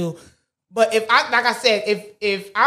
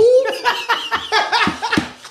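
bursts into a high laugh; 10 semitones higher than usual. Real, not acted. Laughs after acting out a brush-off.

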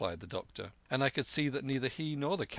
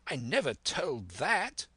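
higher-pitched protest; crying out; 5 semitones higher than usual. A narrator's reading. Squire denies leaking the map secret, crying out. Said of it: "cried the squire".

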